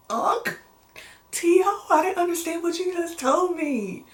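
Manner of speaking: high-pitched confused reply. Retold or imitated. Acts out own confused reply.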